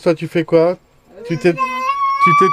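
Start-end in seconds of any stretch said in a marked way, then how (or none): 1.5-2.5 a real jolt of alarm, pitched way up; stammering in alarm at his daughter; alarmed cry. Real, not acted. Father startled by child with earphones.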